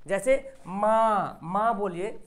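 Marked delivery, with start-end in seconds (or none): 0.7-1.4 long माँ with the n only hummed through the nose; nasal noon ghunna. Shown as a demonstration. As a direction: draw out माँ, nasal vowel, no closing n. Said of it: "जिसकी आवाज़ नाक से निकले". (माँ को नाक से, खींचकर बोलें)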